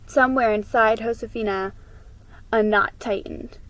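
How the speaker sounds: plain reading voice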